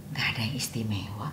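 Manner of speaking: hushed, breathless report; whisper. Retold or imitated. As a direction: whisper it like a secret. Companion reports to Rasulullah. (berbisik pelan)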